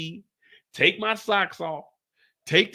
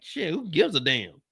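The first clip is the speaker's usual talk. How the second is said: ordinary talk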